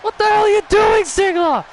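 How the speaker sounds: outraged shout. Real, not acted. Outraged at Ziggler's interference.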